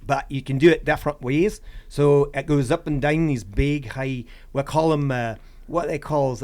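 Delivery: put-on Scottish brogue; Scottish accent. Shown as a demonstration. Hiker showing the host an impersonation of Scottish speech, Scottish accent. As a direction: describe the trail in a homemade Scottish accent. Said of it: "That's my Scottish accent".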